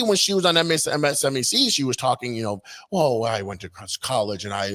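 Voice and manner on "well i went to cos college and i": mock proper voice. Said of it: "very proper".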